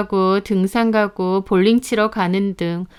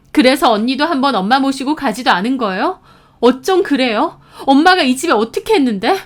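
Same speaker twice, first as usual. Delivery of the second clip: heated, high-pitched scolding; angry reproach; 5 semitones higher, 3 dB louder than usual. A narrator's reading. Sister scolding sister-in-law about their mother, angry reproach. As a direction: lay into her with outrage.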